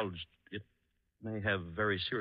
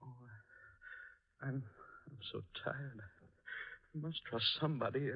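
much softer, worn-out sigh of words; weary collapse; 3 semitones higher, 9 dB quieter than usual. Acted. Doctor giving in to Spade, soft and weary. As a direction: let the fatigue drain your voice.

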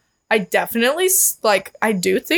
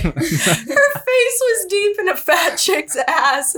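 laughing; 11 semitones higher than usual. Real, not acted. Correcting herself while laughing.

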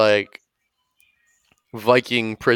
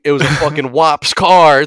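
loud, rising punchline; rowdy bragging; 4 semitones higher, 7 dB louder than usual. Acted. Frat guy gloats about the crash, loud. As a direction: crow it to the guys.

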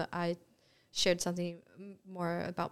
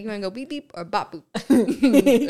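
Minion voice; 3 semitones higher, 14 dB louder than usual. Pretend. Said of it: "you were saying it the whole time".

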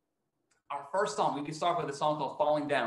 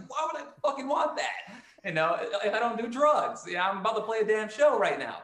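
higher, louder retold anger; mock angry rant at a careless fan; imitated pissed-off rant; 6 semitones higher, 4 dB louder than usual. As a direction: replay the friend fuming at the fan. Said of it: "he comes to me all pissed off".

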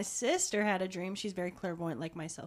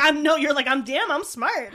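mock smug exclamation; 6 semitones higher, 12 dB louder than usual. Mocking a self-praising thought.

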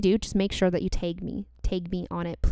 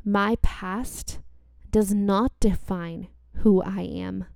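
slow affirmations; slower than usual. Shown as a demonstration. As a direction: speak each line slowly and gently. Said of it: "So repeat after me".

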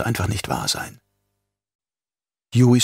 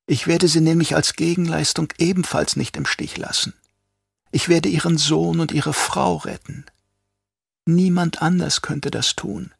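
a touch higher, calm traitor doctor; lightly voiced traitor doctor; Yui, lightly voiced; 7 semitones higher than usual. A narrator's reading. Yui persuades the duke.

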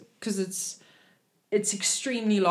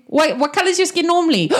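high, hurried question; quick question; 7 semitones higher, 4 dB louder, faster than usual. Acted. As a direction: break off and ask it quickly. Interrupting himself with a question.

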